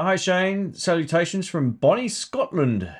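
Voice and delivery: a weak attempt at a Scottish accent, read from chat; half-hearted Scottish accent on a viewer's greeting; a passing go at a Scottish accent; bad Scottish accent. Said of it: "Can't do a Scottish accent very well".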